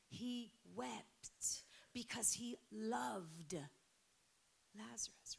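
tender whisper. Real, not acted. Tender aside about Jesus' love.

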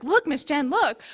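put-on little boy insisting loudly to get attention; eager little boy. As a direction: shout it like an excited kid pushing something in your face. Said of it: "He's like".

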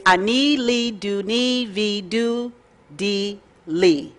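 slow, careful Hebrew, each syllable "Ani li do ni vi do di li" drawn out. Shown as a demonstration. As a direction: spell out "Ani li do ni vi do di li" slowly for learners. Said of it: "I'm gonna say it very, very slow".

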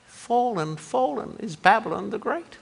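soft, distant voicing of the angel; hushed proclamation. Shown as a demonstration. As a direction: read the cry almost whispered. Soft contrast read of shouted line.

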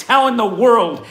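How in the world? shouted high and loud, slow; outraged cry. Shown as a demonstration.

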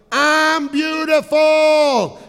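high, loud, drawn-out shout; mock yell. Wife steps out and yells to the canyon, high mock shout. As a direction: holler it long and proud into a canyon. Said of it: "she yelled".

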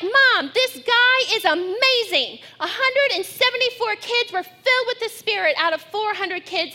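put-on high, loud, breathless kid gushing; excited child. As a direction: rave like a thrilled girl back from camp. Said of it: "she's excited about it".

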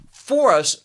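"for us" punched out loud; stress on for us. Shown as a demonstration. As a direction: hit "for us" hard and loud.

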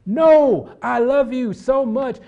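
high, loud, drawn-out refusal; impassioned protest. Acted. Preacher acts out a disciple refusing to let Jesus suffer, impassioned protest. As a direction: cry out in loving objection.

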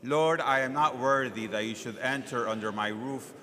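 normal talking voice

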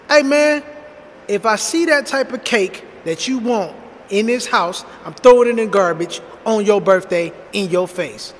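forceful acted-out threat from a fed-up father; retold stern warning. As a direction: lay down the law to a child, punching the phrases. Retold father warning his son.

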